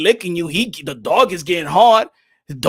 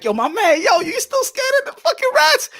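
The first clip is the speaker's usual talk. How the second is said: much higher mocking friend voice; teasing friends. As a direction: needle him about the rats, high and teasing. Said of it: "my peoples be laughing like".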